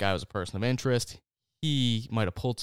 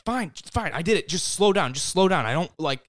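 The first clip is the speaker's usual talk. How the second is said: high, raised-voice imitation of a panicked son; panicked confession; 8 semitones higher, 5 dB louder than usual. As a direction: blurt it out, begging the driver to stop. Acted panicked confession in speeding car.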